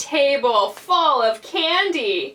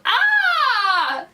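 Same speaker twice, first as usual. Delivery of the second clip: high held "ah" scream; startled yell; 10 semitones higher, 4 dB louder than usual. A narrator's reading. Big man sees Lance's painted face, startled yell. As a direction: scream out, then fall away. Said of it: "And the man yelled".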